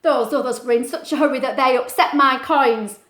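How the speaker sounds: loud, grumpy complaint; grumbling. Acted. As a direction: grumble it at the fish. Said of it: "the lobster grumbled".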